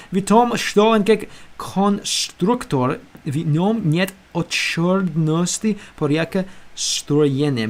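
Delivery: slow, careful reading of a foreign text; slow reading aloud. Shown as a demonstration. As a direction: read the lines word by word like a learner.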